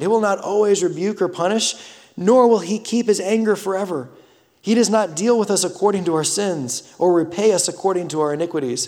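fervent pulpit reading; preaching scripture. Acted. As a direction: proclaim it to the whole church. Scripture read at full force.

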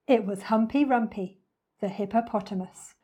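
plain reading voice

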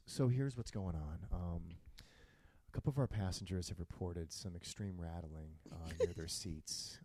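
low, quiet captain announcement; calm airline captain on the intercom; pilot voice. Retold or imitated. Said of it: "So he goes".

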